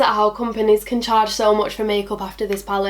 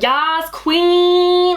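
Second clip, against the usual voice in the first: high, loud, drawn-out mock-American cheer; hammed-up American exclamation; 8 semitones higher, 5 dB louder than usual. Mimicking such an American thing, hammed-up American accent. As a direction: stretch it out like an over-the-top American. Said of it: "that's such an American thing".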